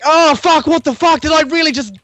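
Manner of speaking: high, loud panicked swearing; high freaked-out voice; freaking out. Acted. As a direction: squeal it out in full panic. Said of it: "You're like".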